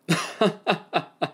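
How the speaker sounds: sudden high laugh, cracking up; burst of laughter. Acted. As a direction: break into a real belly laugh. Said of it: "Hewitt cracked up".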